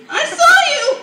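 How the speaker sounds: put-on high, loud outraged kid; hammed-up shouted accusation; mock accusing shout. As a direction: yell it like catching Dad in the act. Kid accusing dad of sleeping.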